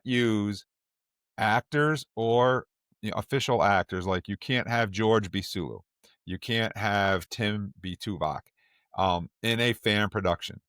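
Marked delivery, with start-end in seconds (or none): none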